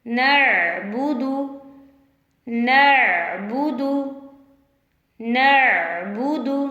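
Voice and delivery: नअबुदु with a light, thin न, slow and drawn out; teacher's slow model of नअबुदु; thin noon. Shown as a demonstration. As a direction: recite नअबुदु slowly, keeping the न thin. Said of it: "नून को मोटा नहीं करना।".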